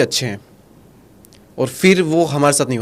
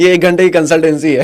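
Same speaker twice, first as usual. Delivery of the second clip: louder, laughing through the words; laughing reply; 4 semitones higher, 7 dB louder than usual. Real, not acted. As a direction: joke back while laughing. Host laughs back at the joke. (हँसते हुए जवाब)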